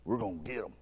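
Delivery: a quiet determined aside to the wife; hushed excitement. Retold or imitated. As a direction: fisherman quietly vowing to his wife. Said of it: "I was like".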